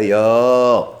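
"يا" drawn out long on the aa; vocative long vowel. Shown as a demonstration. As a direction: hold the alif in "يا" as a calling particle. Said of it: "حرف النداء الياء وألف". (مدّ الألف طويلاً في «يا»)

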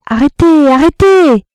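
cri très aigu et très fort; crié de loin. A narrator's reading. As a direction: hurler pour stopper le duel. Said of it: "cria une voix qui venait de la route". (cri lointain et perçant)

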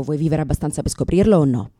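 ordinary talk

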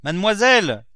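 high, loud hail; crying out. A narrator's reading. Cook hailing the young mistress with news, shouted call. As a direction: shout for someone in another room. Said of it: "cria la cuisinière".

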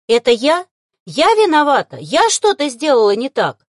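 loud, high, anxious questions; alarmed worry. A narrator's reading. Novice necromancer fears she is to blame, alarmed. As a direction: ask urgently, afraid it's your fault. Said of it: "Тут же обеспокоилась".

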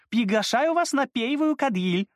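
put-on high girlish voice with "пейвую" for "первую"; says w for r. A narrator's reading. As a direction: lisp "пейвую", r dropped, high and bright. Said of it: "разнообразно картавя".

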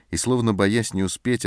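ordinary delivery

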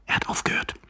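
unvoiced whisper after a shush; hushed warning. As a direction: shush him, then whisper. Hiding men fear being heard.

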